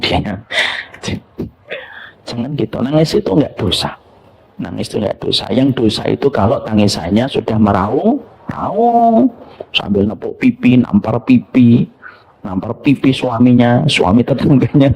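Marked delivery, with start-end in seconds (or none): none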